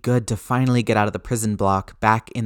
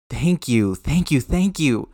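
gushing thanks; 4 semitones higher, 4 dB louder, slower than usual. Acted. Grateful Peter gushing.

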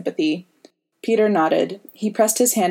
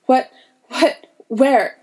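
high, breathless burst of questions; startled panic; 7 semitones higher, 4 dB louder, slower than usual. A narrator's reading. Boy waking from a nightmare, startled and panicked. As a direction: jolt awake in fright, voice jumping up.

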